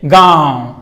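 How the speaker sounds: गाँव drawn out slowly with extra force on the nasal vowel; stressed, long nasalised vowel in गाँव; emphatic nasal vowel. Shown as a demonstration. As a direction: stretch the आँ in गाँव through mouth and nose. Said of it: "ज़्यादा जोर देके बोलना पड़ता है".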